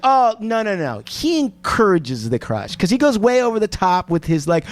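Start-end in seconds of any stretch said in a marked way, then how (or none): none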